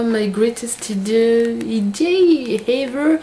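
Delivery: drawn-out English in a French accent. Real, not acted. Vlogger reading out what is written on the clips, French-accented English. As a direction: stretch each English word slowly, French accent. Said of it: "Avec mon super accent".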